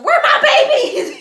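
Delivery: high, loud, drawn-out holler; frantic mama yell. Retold or imitated. Mama bursting in looking for her daughter, hollering. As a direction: imitate a mother hollering for her child. Mother arriving furious, imitated.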